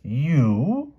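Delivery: high, loud outraged you; explosive outburst. Acted. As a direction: explode with disbelief. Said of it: "exploded King Lot".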